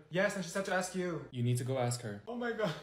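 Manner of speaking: ordinary talk, nothing marked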